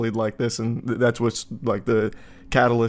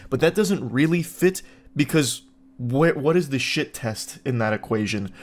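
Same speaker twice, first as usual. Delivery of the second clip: ordinary delivery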